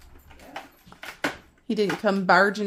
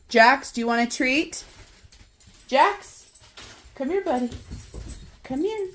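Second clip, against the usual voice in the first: talking to a dog; 6 semitones higher than usual. Real, not acted. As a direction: sweet-talk the puppy over. Coaxing the dog with treats.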